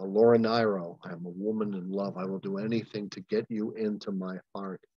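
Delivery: normal talking voice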